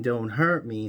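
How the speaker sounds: a retold plea with barely any lift; mild mock scream.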